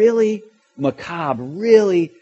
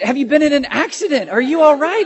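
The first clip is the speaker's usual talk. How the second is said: high, louder, anxious asking; worried questions; 10 semitones higher, 7 dB louder than usual. Retold or imitated. Girlfriend at the door fears he had an accident, anxious. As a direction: fear he has been hurt. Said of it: "and then she said".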